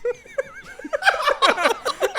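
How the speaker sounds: loud high cackle-like laugh; high laughter. Real, not acted. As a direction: laugh hard at how absurd it was. Laughing at the principal quote.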